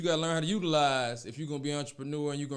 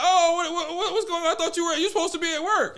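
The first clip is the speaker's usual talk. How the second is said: high mock-innocent squeal with laughter; flustered girlfriend; 11 semitones higher, 3 dB louder than usual. Girlfriend caught with another man, high laughing mock innocence. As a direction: play the caught cheater, squeaky and fake-surprised.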